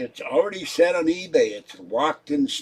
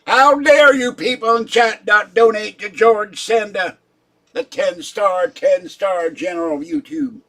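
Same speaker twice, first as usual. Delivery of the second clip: higher and louder, mock outraged tirade; ten-star general persona; 6 semitones higher, 8 dB louder than usual. Scolding chat for not donating, loud mock-outraged general. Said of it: "I could talk like that forever".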